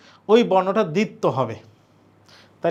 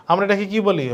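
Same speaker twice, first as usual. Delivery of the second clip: ordinary delivery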